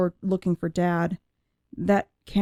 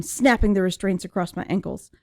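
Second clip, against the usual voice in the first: ordinary delivery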